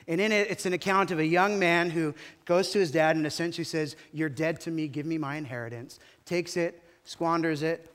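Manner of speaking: loud, driving sermon storytelling; preaching at full force. Acted. Pastor telling the prodigal son to his congregation, preaching loud. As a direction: push the story out to the whole room.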